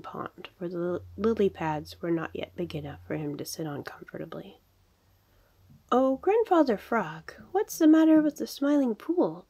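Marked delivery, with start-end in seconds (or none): 5.9-9.5 high, loud storybook shout; urgent raised-voice holler; shouted question. A narrator's reading. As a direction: call up to an elder in alarm. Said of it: "they shouted as they came up".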